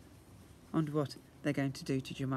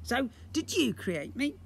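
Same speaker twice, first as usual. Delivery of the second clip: high creature voice; 9 semitones higher than usual. Acted. Slime asks.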